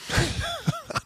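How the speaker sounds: a short laugh shooting way up; amused laugh at the idea; high laugh. Real, not acted.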